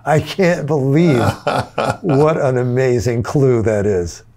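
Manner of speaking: slow, high, amazed and close to tears; laughing and crying. Retold or imitated. Two musicians on the phone amazed at the clue, slow and tearful-laughing. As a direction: marvel at it as if about to cry. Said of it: "laughing and crying".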